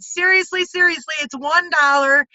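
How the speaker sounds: excited April Tucker. Retold or imitated. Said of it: "She was so excited".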